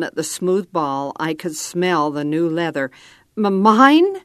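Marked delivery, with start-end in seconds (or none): 3.4-4.2 higher, louder, halting start; stammering disbelief. A narrator's reading. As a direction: stumble over the word in amazement. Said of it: "I stammered".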